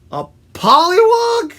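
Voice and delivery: high, loud, puzzled shout; loud bird character questioning; mishearing woodpecker. Acted. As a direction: shout back a wrong guess.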